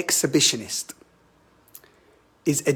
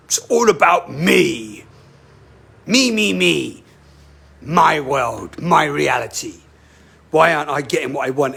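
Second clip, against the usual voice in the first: voicing the ego; 3 dB louder than usual. Voicing ego-driven entity.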